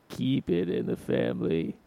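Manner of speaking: low, slow mob boss growl; mafia voice. Pretend. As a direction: lean into the Jersey mafioso. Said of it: "that's my mafia voice".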